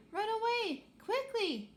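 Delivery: high, loud urgent cry; urgent warning. Acted. Astra warning the crew of lurking cakes, urgent high shout. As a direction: shout them to safety.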